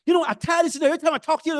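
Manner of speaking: Barbadian accent. Vexed friend from Barbados scolding speaker, imitated Barbadian accent. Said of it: "the accent of a person who is from Barbados".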